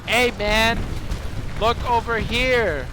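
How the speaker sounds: acted distraction, lingering on the words; drawn-out attention call. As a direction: coax everyone's eyes over. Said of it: "that's not what you say".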